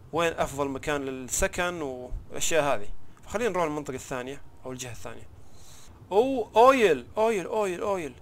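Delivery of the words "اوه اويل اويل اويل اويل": excited discovery. Real, not acted. Spots rare oil in game.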